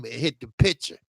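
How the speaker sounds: ordinary talk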